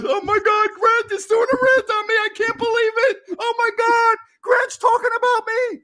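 high, loud whining falsetto, fake sobbing; whiny mock troll. Troll whining to his friends about the rant, in a high mock falsetto. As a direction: squeal it like a crybaby tattling to friends. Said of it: "He's on his phone telling all of his guys".

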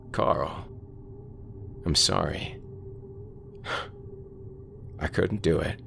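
soft, half-whispered remorse; a quiet, broken apology; hushed and guilty. Acted. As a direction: confess it softly, ashamed and scared. Carl hushed, guilty on the phone.

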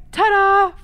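loud high showman's ta-da; loud cheery ta-da. Retold or imitated. Dad joking about any mishap, loud showman's ta-da. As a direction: present it like a magic trick. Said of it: "He's like".

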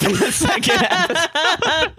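high, slow laugh-through words; can barely finish for laughing. Real, not acted.

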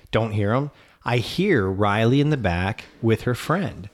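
normal talking voice

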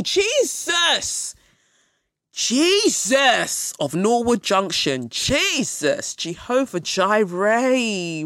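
high, loud, drawn-out alarm; shocked exclamation. Pretend. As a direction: call on the Lord in mock horror, stretched. Reacting to seven-foot snake.

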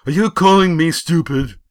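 high, loud, affronted outburst; angry challenge. A narrator's reading. Bully insulted by his rival, angry shout. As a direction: demand it, ready to fight. Bully takes offence in fight.

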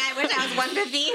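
amused, laughing wish. Real, not acted. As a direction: laugh while joking about yourself. Joking about weight amid laughter.

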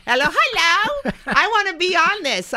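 high, loud goofy voice; dum-dum voice. Retold or imitated. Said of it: "like a dum-dum".

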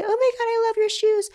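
very high fake-compliment squeal; mock gushing women. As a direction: gush in a put-on high voice.

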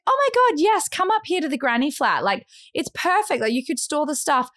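high, gushing welcome; eager offer. Retold or imitated. Host offering the granny flat to visitor, eager. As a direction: pitch it up, eagerly inviting.

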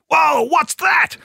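high, slow startled cry; startled whoa. Retold or imitated. Brad startled by a loud noise, high surprised cry. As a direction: jump at a sudden noise.

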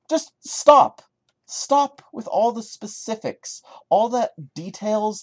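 high, loud plea to stop; loud, high fed-up cry; exasperated shout. Real, not acted. Exasperated at the story.

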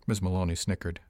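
ordinary delivery, nothing marked

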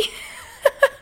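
high, breaking burst of laughter; real laugh. Real, not acted. Laughing at her own poor-baby answer, real giggles. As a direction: crack up at your own silliness.

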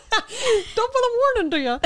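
very high, hammed-up Irish squeal; jokey Irish accent. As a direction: shriek a cartoon Irish greeting, laughing. Jokey accent after Irish talk.

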